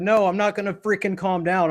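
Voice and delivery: retold heated refusal to calm down; mock angry refusal. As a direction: push back hot-headed, half playing it up. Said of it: "I said".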